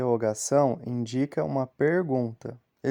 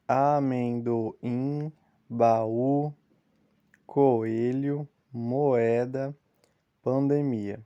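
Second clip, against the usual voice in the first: slow example word; much slower than usual. Shown as a demonstration. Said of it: "Por exemplo".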